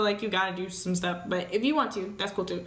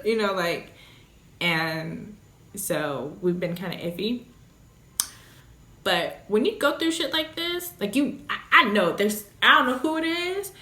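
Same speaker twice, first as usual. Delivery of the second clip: ordinary delivery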